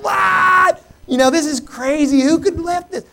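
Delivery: high, loud outraged disbelief; retold indignant outburst; mad. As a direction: rant at whoever left the mess. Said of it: "I am mad. I'm like".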